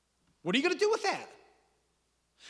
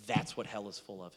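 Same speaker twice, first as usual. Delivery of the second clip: ordinary talk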